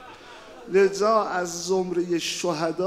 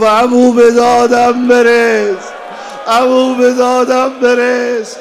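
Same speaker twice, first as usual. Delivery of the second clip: high, loud and slow call for rescue; loud, slow, high mourning cry; anguished cry for help; 6 semitones higher, 14 dB louder than usual. Acted. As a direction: wail it as the dying boy calling out. Dying boy cries to uncle.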